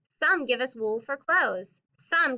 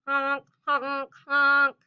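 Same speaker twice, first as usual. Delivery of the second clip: loud goose honking; 2 semitones higher, 5 dB louder, much slower than usual. Acted. Teacher reading the goose page, honking. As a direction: honk like a cross goose. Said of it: "I'll honk and hiss just like this".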